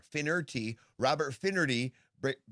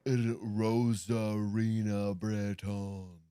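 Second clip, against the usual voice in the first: slow, lower, labored American reading of the name; 5 semitones lower, much slower than usual. Retold or imitated.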